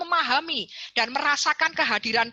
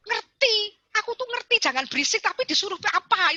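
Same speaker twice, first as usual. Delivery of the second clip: put-on high, protesting kid voice; light child voice, pitched up; whiny child; 8 semitones higher than usual. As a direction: complain like a fed-up child. Child answering the parent.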